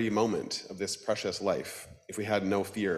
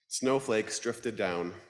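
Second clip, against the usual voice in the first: ordinary talk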